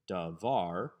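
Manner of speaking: davar stressed on its final syllable, var; stress on second syllable. Shown as a demonstration. Teacher shows why it's no segelet, second-syllable stress on davar. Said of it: "the emphasis is on the second syllable".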